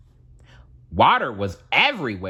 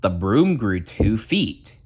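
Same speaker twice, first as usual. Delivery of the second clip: usual speaking voice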